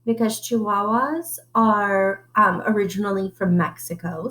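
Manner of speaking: usual speaking voice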